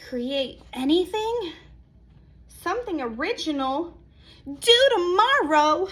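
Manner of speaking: panicky. Acted. Panicked inner questions.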